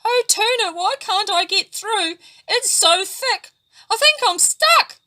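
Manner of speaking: high, loud, tearful fear; trapped flatfish calling Tuna for help; scared. A narrator's reading. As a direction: plead in rising panic. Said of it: "Patiki was scared".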